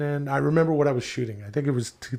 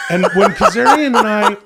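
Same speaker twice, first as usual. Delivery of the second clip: laughing; 9 semitones higher, 5 dB louder than usual. Real, not acted. Laughing after the New York bit.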